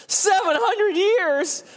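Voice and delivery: very high, slow, laughing squeal; shocked disbelief. Retold or imitated. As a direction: shriek out in comic disbelief. People react to God's answer.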